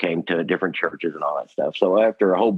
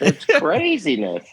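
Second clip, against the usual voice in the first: high, slow, amused It's craziness; amused disbelief; 9 semitones higher, slower than usual. Real, not acted. Telling the hosts about fans, high slow amused It's craziness. Repeats phrase, first marked.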